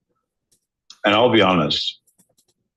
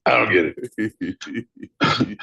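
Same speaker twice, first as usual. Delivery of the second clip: normal talking voice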